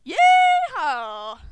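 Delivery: loud cowboy whoop; cowboy yeehaw. Pretend. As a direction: holler it like a rodeo cowboy.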